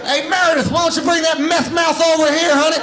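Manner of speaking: high, loud hillbilly-husband call; mock West Virginian husband. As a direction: holler for your woman, hammed up. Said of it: "Great impression".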